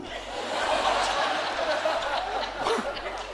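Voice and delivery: long breathy laugh. Real, not acted. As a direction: laugh at yourself. Laughing at own gaffe.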